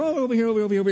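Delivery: high, eager calling across a crowd; parents calling out. Retold or imitated. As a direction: wave them over in a lively voice. Mamas calling to their kids.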